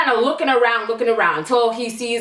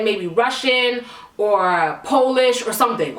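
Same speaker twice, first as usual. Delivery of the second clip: ordinary delivery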